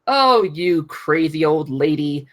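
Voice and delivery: ordinary delivery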